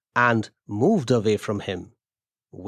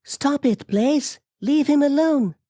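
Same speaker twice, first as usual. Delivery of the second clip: very high-pitched female character shout; high, urgent command to a dog; woman's shout. A narrator's reading. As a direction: call out high and urgent, without great volume. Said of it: "Amelia shouted".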